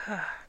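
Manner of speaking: resigned sigh. A narrator's reading. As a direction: let out a short sigh. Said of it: "Nellie May sighed".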